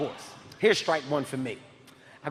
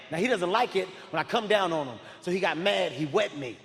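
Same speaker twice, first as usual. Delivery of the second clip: ordinary talk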